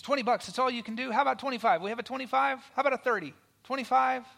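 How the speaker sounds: loud, high auctioneer working the room. Acted. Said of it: "And the auctioneer says".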